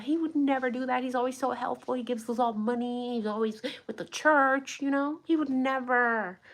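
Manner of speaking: mock naive townsfolk. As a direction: imitate churchy neighbours who can't believe it. Townspeople defending the killer.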